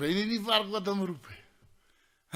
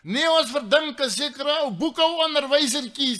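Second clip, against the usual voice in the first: raised, louder reply; shouting down; 7 semitones higher, 5 dB louder than usual. Acted. Man in the stand names the suspect, shouting down. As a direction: holler the explanation down. Shouted exchange across stadium.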